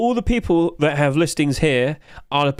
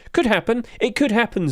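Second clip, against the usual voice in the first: hopeful; 6 semitones higher than usual. Real, not acted. Answering viewer, first take.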